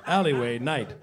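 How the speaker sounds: ordinary talk, nothing marked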